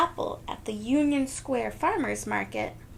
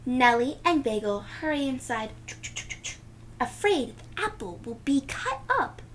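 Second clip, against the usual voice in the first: usual speaking voice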